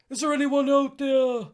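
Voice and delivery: high, loud call into the distance; rescuer calling out. Acted. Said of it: "I can do all those".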